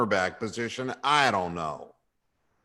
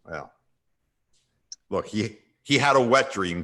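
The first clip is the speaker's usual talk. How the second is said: usual speaking voice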